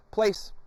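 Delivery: sharp high cue word; place command. Shown as a demonstration. Trainer giving the dog a place command. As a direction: call the dog to his spot. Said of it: "So I say the command".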